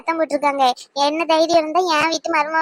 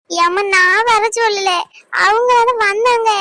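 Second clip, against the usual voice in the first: crying, higher, slow plea; acted crying; 4 semitones higher, slower than usual. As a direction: whimper an excuse to mother. Daughter pleading with angry mother. (அம்மாவிடம் அழுதுகொண்டே கெஞ்சுதல்)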